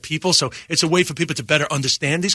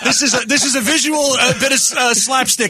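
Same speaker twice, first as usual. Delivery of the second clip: laughing; 8 semitones higher than usual. Real, not acted. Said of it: "He got sad all of a sudden".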